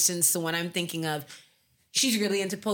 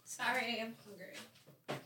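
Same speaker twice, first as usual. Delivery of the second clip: soft hushed apology; whispering; 14 dB quieter, much slower than usual. Real, not acted. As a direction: whisper it away from the mic. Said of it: "I can still hear you".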